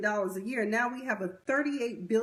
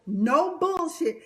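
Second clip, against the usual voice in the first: mocking [name]; 5 semitones higher, 5 dB louder, slower than usual. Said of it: "this is how professional [name] is".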